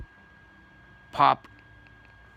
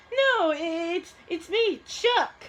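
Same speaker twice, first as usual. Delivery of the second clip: much higher squeaky character voice; Chuck's voice; 8 semitones higher, much slower than usual. Acted. Chuck answering a friend who thinks he's Porky Pig, high voice. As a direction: pitch way up for a small cartoon character.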